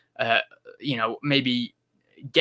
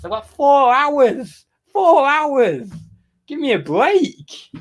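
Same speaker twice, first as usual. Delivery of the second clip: exasperated high shout; 11 semitones higher, 6 dB louder, much slower than usual. Real, not acted. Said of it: "Everyone's moaning".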